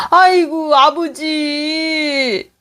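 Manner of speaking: high wailing cry. Acted. Son wailing in despair at his father's answer. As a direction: break into a sob on father's name.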